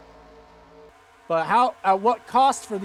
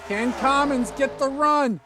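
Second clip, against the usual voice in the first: excited play-by-play; 2 semitones higher, 5 dB louder than usual. Real, not acted. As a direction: call the finish at full excitement. Race call climax, S0 shouting.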